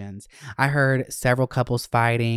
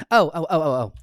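ordinary delivery

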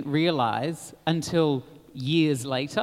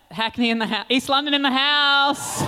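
hype shout; 7 semitones higher, 4 dB louder than usual. Real, not acted. Hyping her home borough.

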